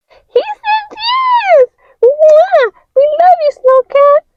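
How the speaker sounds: very high, excited squeal; high delighted shrieks; joyful cheer. Acted. Figures celebrate.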